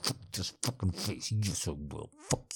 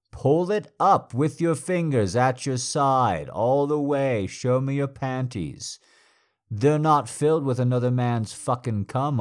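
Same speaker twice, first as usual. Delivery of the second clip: louder, higher domineering orders; loud commanding; 6 semitones higher, 7 dB louder than usual. Acted. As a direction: press the commands hard and loud. Dominant partner orders loudly.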